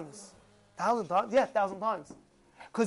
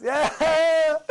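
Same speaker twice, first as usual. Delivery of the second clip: loud, laughing mock-delighted yeah; cackling eager cheer; excited; 3 semitones higher, 6 dB louder than usual. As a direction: burst out with hammed-up glee. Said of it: "Oh, you excited?".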